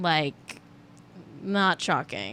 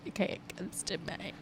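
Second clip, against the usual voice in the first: very soft, almost to yourself; muttered aside; 2 semitones lower, 14 dB quieter than usual. Real, not acted. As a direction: drop right down to a near-whisper. Repeats own remark as aside.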